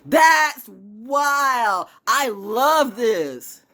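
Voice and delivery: thrilled. Real, not acted. Marvelling at a sign to viewers, high excited gush. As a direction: savour the wonder out loud.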